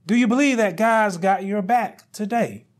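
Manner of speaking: usual speaking voice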